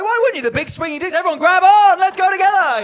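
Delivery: rally the crowd like a swaggering bro; hammed-up rally cry. Comic boasting about the sex party crowd, whooping rally.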